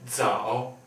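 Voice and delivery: zao tief, fallend und wieder steigend; third tone. Shown as a demonstration. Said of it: "Third tone".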